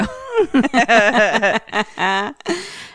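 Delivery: long laugh; real laughter bursting out. Real, not acted. Player laughing after threatening the storyteller, laughing.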